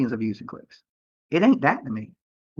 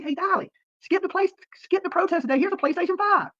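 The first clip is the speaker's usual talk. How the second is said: much higher, sing-song mock voice; mock gift-giver; 13 semitones higher than usual. Dolly mocks a fan handing him a PlayStation, high mock voice. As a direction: ham up an imaginary fan bearing gifts.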